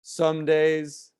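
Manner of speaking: slow, measured poem recitation with long pauses; spoken-word poem, unhurried and deliberate; poetry reading. Acted. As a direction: recite it line by line, letting each phrase hang.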